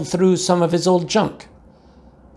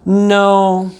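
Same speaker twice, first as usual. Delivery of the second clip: firm, louder mama no; 5 semitones higher, 5 dB louder than usual. A narrator's reading. Mother refusing the sisters, firm and louder. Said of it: "answered Mama Junebug Jones".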